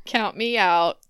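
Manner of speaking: ordinary talk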